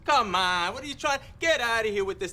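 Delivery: high, loud, fed-up rejection; angry outburst. Acted. Bartender kicking out man and talking dog, angry shout.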